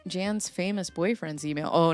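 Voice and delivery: ordinary talk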